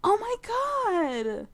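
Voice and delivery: high, slow breathless oh my God; drawn-out disbelief; stunned gasp. Real, not acted. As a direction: let it fall out in shock. Gasp at kiss.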